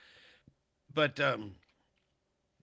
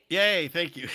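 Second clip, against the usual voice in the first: excited cheer; 6 semitones higher, 7 dB louder than usual. Real, not acted. Cheering a donation.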